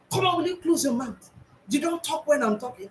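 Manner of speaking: a hint of a stern adult telling a child to shut up; imitated scolding. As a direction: snap at the kid to hush, mock-stern. Scolding a child while growing up.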